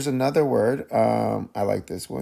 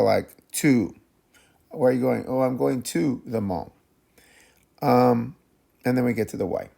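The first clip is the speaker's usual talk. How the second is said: usual speaking voice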